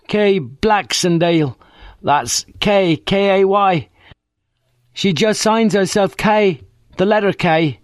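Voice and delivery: put-on cheeky working-class girl on the phone; brash young woman. A narrator's reading. Friday Banks answers the inspector, cheeky and common.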